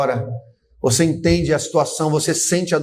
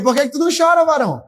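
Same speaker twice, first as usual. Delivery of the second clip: high, louder mock challenge; raised playful challenge to the man beside you; louder teasing; 7 semitones higher, faster than usual. Shown as a demonstration. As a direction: call him out, half-joking. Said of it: "Diga aí".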